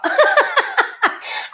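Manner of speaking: high bright laugh breaking out; burst of laughter. Real, not acted. Laughing at her own confession to the viewers.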